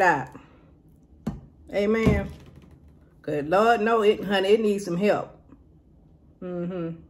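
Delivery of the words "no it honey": ordinary talk, nothing marked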